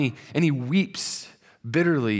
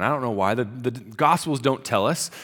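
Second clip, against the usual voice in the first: usual speaking voice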